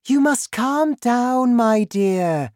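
very high, loud, drawn-out voice; wife. A narrator's reading. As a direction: call down the table to settle your husband. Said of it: "said Missus Cranky".

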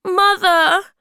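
very high, loud wail for mother; crying out. A narrator's reading. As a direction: call out to mother in protest. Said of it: "Moomintroll cried".

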